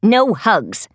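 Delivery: low, lightly voiced refusal; firm Lizzie. A narrator's reading. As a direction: drop low and shut it down.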